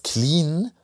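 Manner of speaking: Clean? rising high in disbelief; incredulous echo. Shown as a demonstration. Host mocking the word clean in disbelief. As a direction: repeat clean as if it makes no sense. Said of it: "Bist du doof oder was?".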